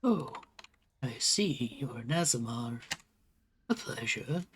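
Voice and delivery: lightly voiced wise old turtle, unhurried; old turtle leader. Game master voices elder turtle leader.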